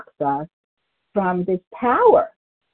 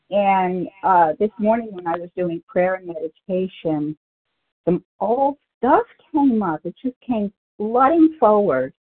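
ordinary delivery

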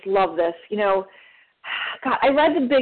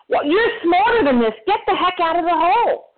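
higher, louder scolding yell; retold yell; 9 semitones higher, 3 dB louder than usual. Friends yelling down at her in the hole, exasperated loud scolding. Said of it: "they yell at me".

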